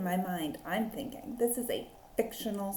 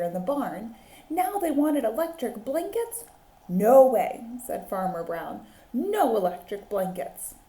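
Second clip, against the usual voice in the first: put-on lively read-aloud for children; animated picture-book reading; story narration; 4 dB louder than usual. A narrator's reading. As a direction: tell it like a teacher at story time.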